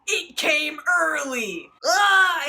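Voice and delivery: a sudden, hammed-up high yell; mock scream. Frightened narrator screaming at Carl on the couch. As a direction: shriek it, startled and over the top.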